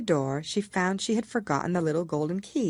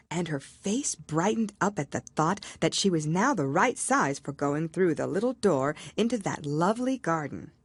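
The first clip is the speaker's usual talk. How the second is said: ordinary delivery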